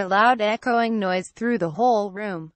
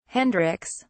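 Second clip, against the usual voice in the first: ordinary delivery, nothing marked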